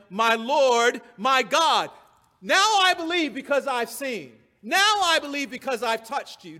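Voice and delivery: belted-out declaration of faith; Thomas's exclamation. Acted. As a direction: exclaim it like an awestruck convert, preacher-style. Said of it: "Thomas answered and said to him".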